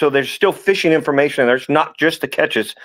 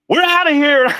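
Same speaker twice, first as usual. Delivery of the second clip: high, startled exclaim; panicked bail-out; 12 semitones higher than usual. Retold or imitated. Guide bolting from a snake, high startled yelp. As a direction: yelp it like you've just seen fangs. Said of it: "and it's like".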